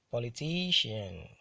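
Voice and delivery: "politician" with TI hit harder; careful model reading of "politician", stressed on TI; penultimate stress. Shown as a demonstration. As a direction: lean on TI in "politician", then fall. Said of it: "stressed on the penultimate syllable".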